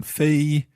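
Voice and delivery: "Fei" held high and flat; first tone. Shown as a demonstration. Learner working out tones, "Fei" high and level. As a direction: keep "Fei" up on a level pitch. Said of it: "the high one".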